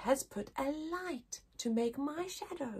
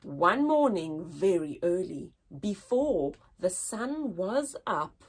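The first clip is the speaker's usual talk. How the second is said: put-on poem reading to children. Teacher reading the poem aloud to her class. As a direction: read it gently with long pauses.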